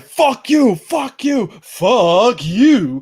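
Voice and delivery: loud, high mock yelling; mock villain shout. Mimicking a villain yelling at the crew, loud and high.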